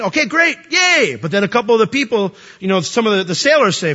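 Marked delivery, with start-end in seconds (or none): 0.0-1.1 mock cheer.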